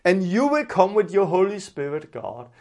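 ordinary talk

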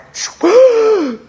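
high loud gasp for air; big gasp. Retold or imitated. Boy rising from baptism water, big gasp. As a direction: surface from the water gasping.

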